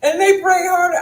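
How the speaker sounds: high and loud through laughter; laughing punchline. Real, not acted. Telling friend the prank worked, laughing loud. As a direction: crack up delivering the punchline.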